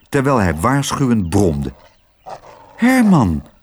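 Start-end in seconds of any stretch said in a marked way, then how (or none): 2.8-3.5 HERMAN called out high, falling; calling by name. A narrator's reading. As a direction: hail the dog by name, pitched up. Girl addresses the growling dog.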